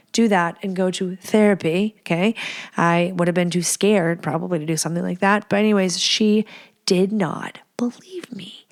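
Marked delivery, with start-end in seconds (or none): 6.0-8.6 slow breathy whisper of disbelief; whispered aside. Real, not acted. Telling viewers about the therapist, slow whisper. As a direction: drop to a slow whisper, letting it sink in.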